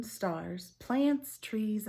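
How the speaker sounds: usual speaking voice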